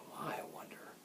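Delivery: hushed, barely voiced question; whispered wondering. A narrator's reading. Jack puzzles over the mean yelling, whispering. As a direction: whisper it to yourself.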